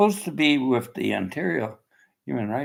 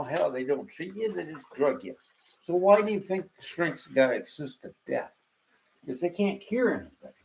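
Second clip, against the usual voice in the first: ordinary delivery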